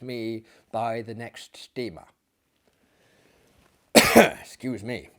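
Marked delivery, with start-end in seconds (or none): none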